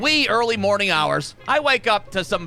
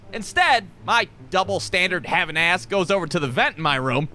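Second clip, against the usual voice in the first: normal talking voice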